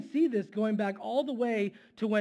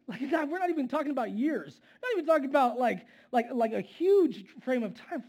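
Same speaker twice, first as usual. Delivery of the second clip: exasperated; 5 semitones higher, 5 dB louder than usual. Real, not acted. Exasperated at Israel's impatience.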